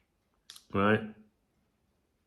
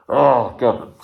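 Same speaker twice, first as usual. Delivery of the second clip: 1 stressed groan demo; 8 dB louder than usual. Shown as a demonstration. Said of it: "See what I mean? I did it straight away".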